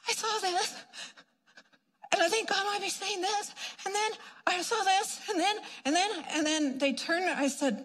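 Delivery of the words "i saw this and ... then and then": high, trembling, unsure voice; mock-timid newcomer reporting a vision; shaky new believer. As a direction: nervously stumble through it.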